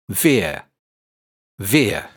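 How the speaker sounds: "Veer" rhymed with ear and near; first syllable veer. Shown as a demonstration. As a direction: isolate the sound "Veer". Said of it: "Veer is pronounced like ear or near".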